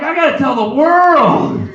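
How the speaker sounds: high, loud, stretched declaration; bursting to share it; gleeful comedian. Acted. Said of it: "the comedian side's just like".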